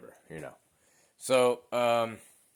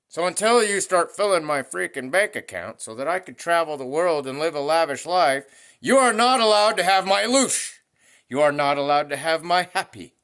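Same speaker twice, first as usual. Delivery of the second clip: loud, higher, pushing hard; defiant rant; 3 semitones higher, 6 dB louder than usual. Real, not acted. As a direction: tell the trespassers off for real. Angry rant against energy thieves.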